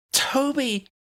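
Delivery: high shouted name; sharp alarmed shout. A narrator's reading. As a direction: call out his name in alarm. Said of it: "shouted Tally".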